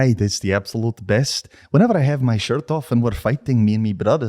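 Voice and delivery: put-on Scots banter; Scottish accent. Co-host tells of fighting with brothers, Scottish accent. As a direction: tell it as a Scotsman. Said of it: "get straight into a Scottish accent".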